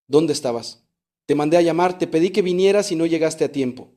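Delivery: ordinary talk, nothing marked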